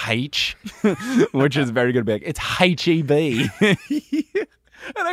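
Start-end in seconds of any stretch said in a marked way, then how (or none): 0.1-0.6 H said as "haitch"; Australian aitch. Retold or imitated. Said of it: "he says "H," ... from Australia". 2.3-3.5 slow, hammed-up Australian "haitch E B"; playful Australian impression; Patty Mills. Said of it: "when Patty Mills says H E B".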